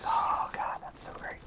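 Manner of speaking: breathy sarcastic aside whisper. Retold or imitated. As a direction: whisper it with mock delight. Retold sarcastic inner reaction.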